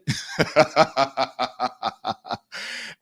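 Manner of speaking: long burst of laughter. Real, not acted. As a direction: laugh heartily at your own story. Laughs after retelling the upgrade offer.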